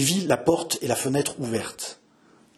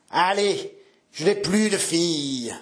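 slow, higher-pitched, drawn-out banishment; stern old father; 5 semitones higher, slower than usual. Acted. Father disowning his daughter at the threshold, stern pronouncement. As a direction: cast your child out in an old man's voice.

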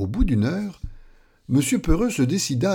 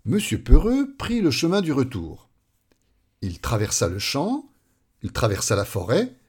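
ordinary delivery

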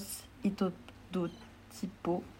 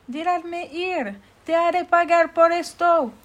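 high furious villain yell; angry villain shout; 11 semitones higher, 11 dB louder than usual. Acted. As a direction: rage and threaten, full volume. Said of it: "El hombre se enoja mucho".

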